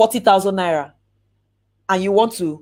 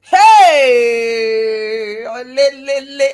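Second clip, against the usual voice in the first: excited call-out; 7 dB louder, much slower than usual. Acted. Crowd call-out cheer.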